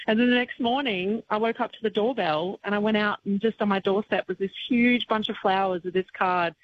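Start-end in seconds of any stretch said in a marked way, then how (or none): none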